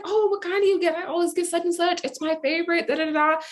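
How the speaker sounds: high, chirpy imitation of small talk; chatty stranger. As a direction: prattle on like an eager stranger. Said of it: "And she's like".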